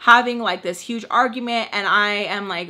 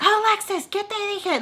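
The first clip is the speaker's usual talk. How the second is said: very high mock scolding; high-pitched imitation of a reprimand; scolding parent figure; 11 semitones higher than usual. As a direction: mimic a telling-off in a high put-on voice. Imitates Mister Peach scolding her.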